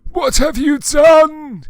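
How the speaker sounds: hammed-up furious old wizard; angry Dumbledore. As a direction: wail it like a scandalised headmaster. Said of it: "Dumbledore walked in angrily".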